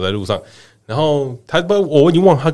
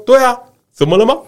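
brazen retort; 10 semitones higher, 3 dB louder, faster than usual. Retold or imitated. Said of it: "然后他就说".

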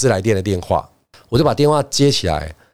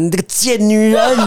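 模仿前女友尖声骂人，音调拔高; 学前女友当街骂人的那一声; 转述的大喊; 9 semitones higher than usual. Retold or imitated. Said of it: "他就很大声喊". (半开玩笑地学她尖声大骂)

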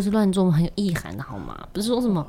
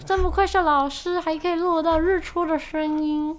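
mock admiration; 11 semitones higher than usual. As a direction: gush over him jokingly. Teasing praise of the teacher.

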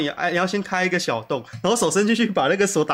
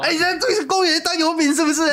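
mocking mom voice; 12 semitones higher, 4 dB louder than usual. Mom finds runaway kid in park.

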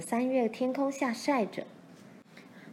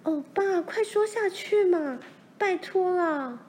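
eager plea; 10 semitones higher, 3 dB louder than usual. A narrator's reading. Laura begs Pa to go on, high and pleading. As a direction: beg Pa to keep going with the story. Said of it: "罗兰急得叫起来".

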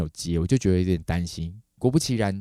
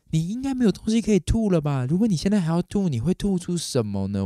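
higher, louder anxious questioning; nervous; 10 semitones higher, 4 dB louder than usual. Retold or imitated. Owner to vomiting dog, dreading what comes next, nervous. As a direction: anxiously reason with a sick pet, pitch raised. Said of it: "心里就有一点点紧张了".

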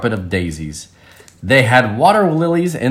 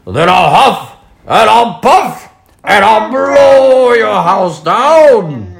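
loud, high, menacing threat; big bad pig; 9 semitones higher, 6 dB louder, slower than usual. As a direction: bellow the threat, slowing at the end.

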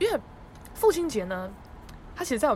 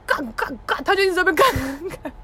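repeated louder swearing; cursing dad; 9 semitones higher, 6 dB louder than usual. Retold or imitated. Dad cursing at the closed quilt shop, mock swearing. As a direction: swear again and again at the shut shop.

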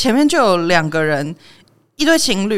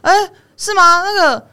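学人家滑手机后突然停下，音调偏高，大声地问; 装成迷糊的路人，拔高声音找楼层; mocking clueless shopper; 8 semitones higher, 4 dB louder than usual. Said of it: "他就停下来这样". (嘲讽地学挡路人高声问路)

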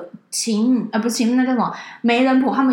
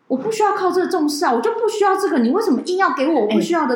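high, raised angry retort; sister shouting; 7 semitones higher than usual. Retold or imitated. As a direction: fire it back at the fiancé. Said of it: "我姐就很大声说".

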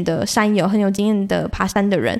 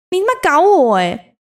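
mock indignation; 7 semitones higher, 4 dB louder than usual. 被骗继续往上爬.

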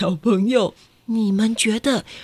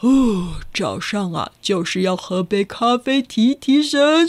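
lightly voiced man; slower than usual. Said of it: "杏仁先生...也说".

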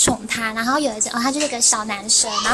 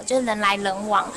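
ordinary talk

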